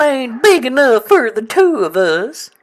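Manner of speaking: high, loud, slow hammed-up cowboy; hammed-up cowboy challenge to a rival. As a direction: drag out a mock Western showdown line. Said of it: "cowboy for a second".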